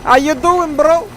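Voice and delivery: high, loud bro greeting; rowdy party animal. Acted. Greeting game character in bro voice.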